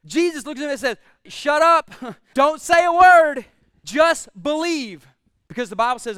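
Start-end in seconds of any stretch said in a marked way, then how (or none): 1.3-5.0 loud, slow commanding burst; forceful command. Acted. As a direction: cut off his doubt with force. Jesus commands Jairus.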